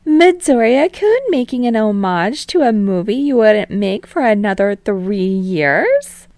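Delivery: put-on playful TV host calming the boys, then prying; teasing talk show host. Host Utsushimi amused.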